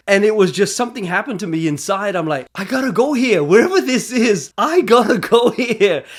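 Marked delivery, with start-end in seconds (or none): 2.6-6.0 higher, emphatic declaration to himself; excited resolve. Retold or imitated. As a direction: punch it out like a sudden calling. Reliving his excited resolve at the scenery.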